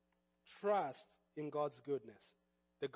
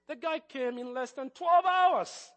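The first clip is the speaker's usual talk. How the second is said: twelve pushed hard, slow and higher; emphatic; 8 semitones higher, 9 dB louder, slower than usual. Retold or imitated. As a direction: lean on twelve, slowly and loud. Stressing how fast they cut him off.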